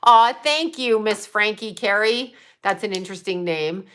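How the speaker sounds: usual speaking voice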